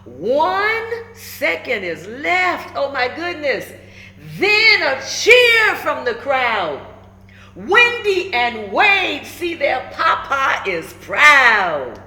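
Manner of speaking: put-on lively, rhyming read-aloud for kids; storybook read-aloud. A narrator's reading. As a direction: bounce the rhymes like a picture book. Reads picture book aloud.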